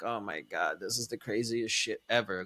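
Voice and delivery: low, hushed disbelief; quiet, dumbfounded aside; stunned aside. Retold or imitated. Retold private reaction to her.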